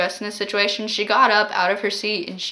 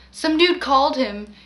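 ordinary talk, nothing marked

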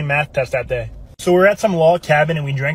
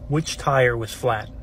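ominous question; 4 semitones lower, 5 dB quieter, much slower than usual. Retold or imitated. Punchline question read out.

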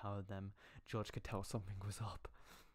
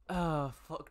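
startled curse; 6 semitones higher, 7 dB louder than usual. Real, not acted. Stumbling while reading aloud.

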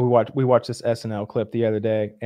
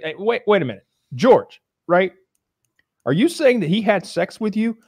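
alarmed professor; 7 semitones higher, 3 dB louder than usual. Retold or imitated. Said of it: "he's like".

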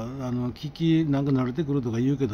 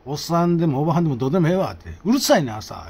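annoyed retort; 5 semitones higher, 6 dB louder than usual. Retold or imitated. Confronting the neighbor.

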